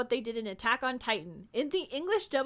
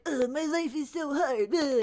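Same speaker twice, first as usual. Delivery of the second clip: lightly mocking gravelly edgelord moan; a hint of a whiny edgy hero; 4 dB louder than usual. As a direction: sigh it like a brooding edgelord, half-joking. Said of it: "gravelly, like".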